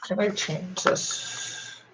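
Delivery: whispering to himself, slowly; whispered. Real, not acted. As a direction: breathe it out under his breath. Muttering to himself while editing.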